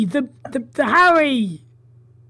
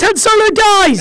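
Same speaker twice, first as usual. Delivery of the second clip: loud, higher yelled spoiler; shouted spoiler; 8 semitones higher, 7 dB louder than usual. Acted. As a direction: yell it to ruin the film.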